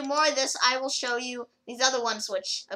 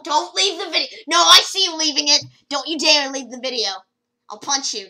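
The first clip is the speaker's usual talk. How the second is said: loud playful mock anger, half joking; fake-scold the viewer for leaving; mock threat; 4 semitones higher, 10 dB louder than usual. Warns viewers not to leave, loud mock anger.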